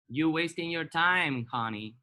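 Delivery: slow, loud sassy English aside; sassy put-down. Pretend. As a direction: drawl it out like a diva. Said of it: "ya estás otra vez en tu criterio". (diva burlona en inglés, lenta)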